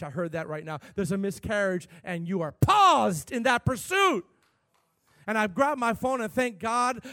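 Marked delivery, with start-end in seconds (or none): 2.5-4.2 PAUSED punched suddenly high and loud; emphatic exhortation. Real, not acted. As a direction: hit the word "paused" hard and stretch it out. Preacher hammers the key word.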